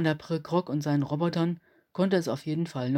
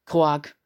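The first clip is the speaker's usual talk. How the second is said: loud croaking yelp; startled alien croak; 7 dB louder than usual. A narrator's reading. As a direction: croak in shock. Alien jumps back startled.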